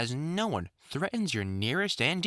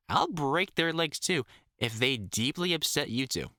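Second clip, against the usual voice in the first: ordinary delivery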